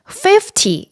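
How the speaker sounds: "fifty" with FIF high and stressed; stress on first syllable. Shown as a demonstration. Teacher modelling tens for learners, first-syllable stress. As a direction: hit the front of "fifty". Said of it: "앞에 강조를 주셔야 돼요".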